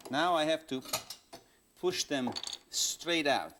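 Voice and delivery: ordinary talk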